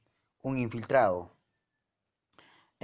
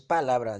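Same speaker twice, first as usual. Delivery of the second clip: "palabra" stressed on PA, said louder; stress on the first syllable; 7 dB louder than usual. Shown as a demonstration. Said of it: "La mayor fuerza está en la pa".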